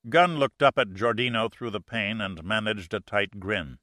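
plain reading voice

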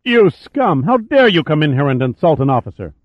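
indignant, high-pitched angry outburst; furious officer, voice jumping up high at the start; malevolent outburst. A narrator's reading. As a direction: spit the insult out with outrage. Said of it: "twisted in a clouded mask of malevolence".

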